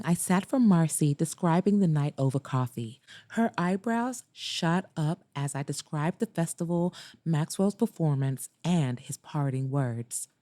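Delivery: usual speaking voice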